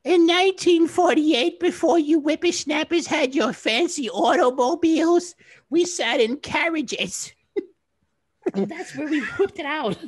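hammed-up old lady, very high and slow; Ethel. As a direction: screech it like a cranky granny being strangled. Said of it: "I'll give you Ethel".

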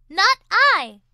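loud careless refusal; dismissive duck. A narrator's reading. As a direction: toss off a loud no. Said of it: "said the duck".

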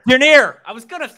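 sudden high, loud burst of recognition; surprised realization. Real, not acted. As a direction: blurt the name as it hits you. Realizing the answer he missed.

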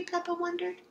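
ordinary delivery

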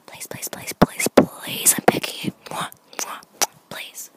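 escalating loud whispered pleading; pleading whisper rising to a loud stage whisper; begging. Real, not acted. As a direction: beg harder and harder, almost shouting the whisper. Begging viewers for a room tour.

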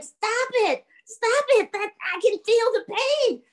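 high, strained cries of protest; pained ghost. Acted. Ghost used as a sled begs him, high pained protest. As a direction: cry out high as if hurting.

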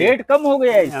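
high-pitched surprised question; आश्चर्य. Real, not acted. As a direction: react with surprise, voice lifted high. Host reacts to low price quote. (हैरानी भरी ऊँची आवाज़ में)

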